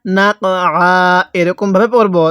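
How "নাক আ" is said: আ left open and held, with no final "n"; waqf pausal reading. Shown as a demonstration. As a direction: stop on a long open আ after নাক. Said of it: "কিভাবে শিখব?".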